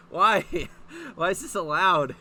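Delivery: loud disbelieving why, cracking into laughter; outraged shout. Real, not acted. Reader yelling at fan fiction in outraged disbelief. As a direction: yell at the page in disbelief.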